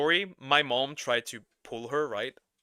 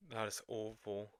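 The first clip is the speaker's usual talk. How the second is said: ordinary talk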